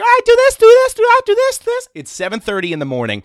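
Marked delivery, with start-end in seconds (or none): 0.0-1.9 much higher and much louder, manic squeal; John the wired boss. Retold or imitated. Coked-up landscaping boss greeting the twelve-year-old, shrieking high and loud. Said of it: "He'd be like".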